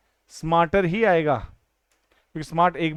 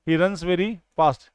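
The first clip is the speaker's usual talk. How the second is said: 1 slow correct sentence; much slower than usual. Shown as a demonstration. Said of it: "क्योंकि fast में कभी भी एलवाई".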